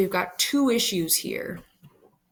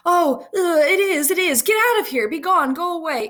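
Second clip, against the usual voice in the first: high, loud, urgent hurrying; suddenly urgent Juliet; 9 semitones higher, 6 dB louder than usual. Acted. Juliet changes her tune and sends Romeo away, urgent. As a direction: abruptly switch to loud, high-pitched shooing. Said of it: "she quickly changes her tune".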